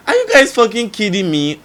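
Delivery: loud, high exasperated comeback; outraged retort. Retold or imitated. Retelling reply to people questioning the tears, outraged.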